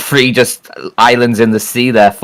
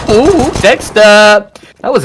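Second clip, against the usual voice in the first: excited; 11 semitones higher, 4 dB louder, slower than usual. Real, not acted. Caster reacting to a great play.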